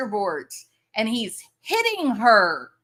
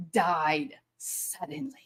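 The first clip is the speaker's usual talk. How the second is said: mock whisper; 5 semitones lower, 7 dB quieter than usual. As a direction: whisper "died suddenly" like a spooky secret. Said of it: "whispered conspiracist quotation".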